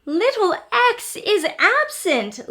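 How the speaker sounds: little A alarmed to the others; little A exclaiming. A narrator's reading. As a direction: announce the news like a tiny alarmed letter. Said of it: "little A exclaimed".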